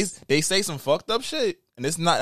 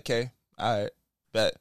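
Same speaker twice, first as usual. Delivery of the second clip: lower, quieter shrug-off; cool dismissive; 8 semitones lower, 6 dB quieter than usual. Retold or imitated. Imitating a guy shutting down the fight, low and cool. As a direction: say it flat and done. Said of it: "be like".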